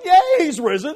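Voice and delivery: playful fake delight; mock cheer. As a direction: chirp it like a hammed-up celebration. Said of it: "She's not going".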